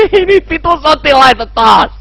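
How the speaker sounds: high-pitched dismayed wail; panicked cry. Real, not acted. Player seeing soldiers return, panicked cry.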